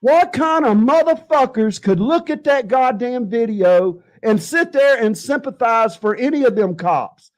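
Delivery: ordinary talk, nothing marked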